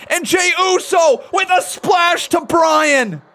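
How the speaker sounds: loud, excited ringside call; wrestling commentator. Acted. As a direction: call the action like a live announcer. Live call of Jey Uso's move.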